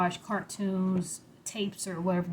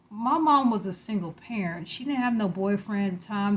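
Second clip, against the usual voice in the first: ordinary talk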